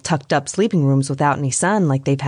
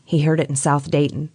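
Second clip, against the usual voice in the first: ordinary delivery, nothing marked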